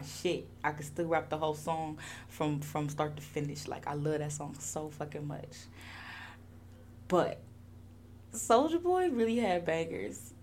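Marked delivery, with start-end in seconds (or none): none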